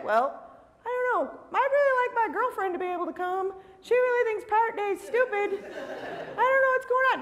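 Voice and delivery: lightly hammed-up doubter; squeaky confused friend; high mock whine.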